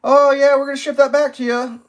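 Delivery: mock the breezy support agent; imitating a cheerful phone rep; chirpy rep voice. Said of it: "I was like ... (rep answers)".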